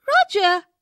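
put-on high small child's answer; little girl voice. A narrator's reading. Jo answering her father, in a high child's voice.

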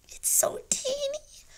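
high, slow breathy whisper of wonder. A narrator's reading. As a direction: hush it in awe at the tiny cub. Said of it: "whispered Annie".

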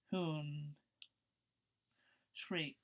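ordinary delivery, nothing marked

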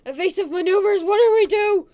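high, loud panicked yelling; mock panic. Rider facing evasive maneuvers, mock panic. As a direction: yell as if about to crash.

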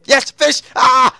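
high, loud scream of joy; triumphant shout. Real, not acted. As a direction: explode as the catch lands. Finally catches a fish.

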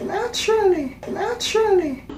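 squeaky exaggerated "naturally" with a full nat-chur-uh-li; high mock voice. Repeating the word in a new voice.

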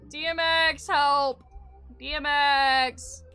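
calling for help. Pretend. Player begging [name] for help, mock calling. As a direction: cry out for rescue, hammed-up.

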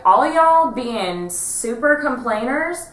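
slow, high, loud diva complaint; sassy put-upon drag queen, drawn out. Retold or imitated. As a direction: ham up a grumpy drag queen. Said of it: "she was like".

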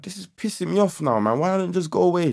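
high-pitched, fed-up mock complaint; retold exasperation. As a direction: replay your fed-up protest to the intruder. Acting out being pissed off.

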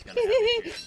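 laughing through the words; high, giggling warning. Real, not acted. Laughing at grave digging.

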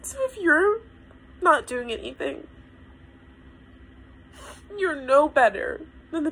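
high voice just after real crying; upset, still choked up; tearful. Real, not acted. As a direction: start again through tears. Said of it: "you've experienced my breakdown".

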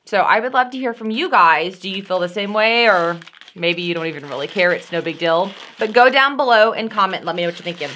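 usual speaking voice

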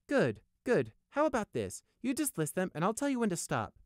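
high-pitched, eager coaxing; encouraging suggestion. A narrator's reading. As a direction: suggest it brightly to flatter him along. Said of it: "Prey suggested".

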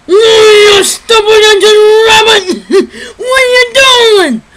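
loud, high, drawn-out cartoon outlaw yell; Yosemite Sam. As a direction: holler like a furious cartoon cowboy. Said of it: "would be Yosemite Sam".

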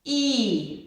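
long held І, vowel sound demo; drawn-out vowel І. Shown as a demonstration. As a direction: hold the sound І for the children. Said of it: "я буду з Кузею промовляти звуки". (протяжне І)

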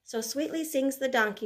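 ordinary talk

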